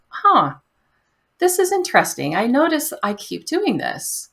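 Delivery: curious noticing. Retold or imitated. Acting out curious self-noticing.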